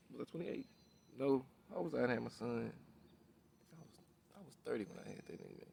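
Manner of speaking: very soft, talking to himself; barely audible self-questioning; thinking aloud. Real, not acted. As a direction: work it out under your breath. Working out his age to himself.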